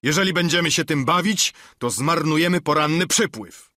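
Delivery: pressing the point loudly; Long John's voice. A narrator's reading. Long John argues. (podniesiony głos Długiego Johna)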